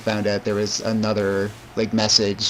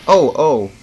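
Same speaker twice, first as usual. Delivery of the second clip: loud sudden oh; sudden realization; 4 semitones higher, 7 dB louder than usual. Real, not acted. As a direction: blurt it out on remembering. Suddenly remembers something.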